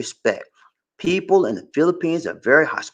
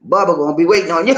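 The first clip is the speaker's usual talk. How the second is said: normal talking voice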